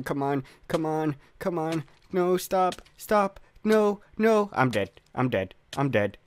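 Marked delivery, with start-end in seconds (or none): 0.0-4.5 pleading that climbs higher; rising panic. Real, not acted. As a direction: beg the game to stop, pitch creeping up. Begging game not to kill.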